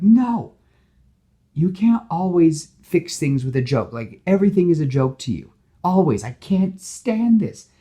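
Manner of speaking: high, loud exasperated rant; angry outburst. Retold or imitated. Gwen tells Peter she can't stand his jokes, loud. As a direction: unload it on him, fed up.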